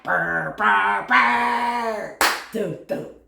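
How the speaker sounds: imitated blown-out bass buzz; booming car speakers. Imitating music bumping from a car in the country, booming speakers. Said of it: "speaker's too big for their car".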